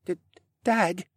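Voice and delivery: put-on halting, high-pitched boy; rasped stammer. A narrator's reading. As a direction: stammer it out through a sore throat. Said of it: "Rylan rasped out".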